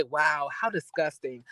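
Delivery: low, soft, slow and disdainful; scoffing disgust. Retold or imitated. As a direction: sneer it out quietly in disgust. Lisa quoted scoffing at Kathy.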